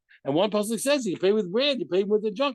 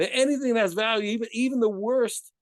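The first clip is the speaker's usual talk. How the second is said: higher-pitched, carried-away teaching; excited; 6 semitones higher than usual. Real, not acted. Teacher carried away explaining the rule to students, excited. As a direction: get carried away making the point, pitch lifted. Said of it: "I just got excited".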